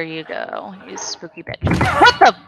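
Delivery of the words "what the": high, loud startled yelp. Real, not acted. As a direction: jolt with a yelp. Jump scare in horror game.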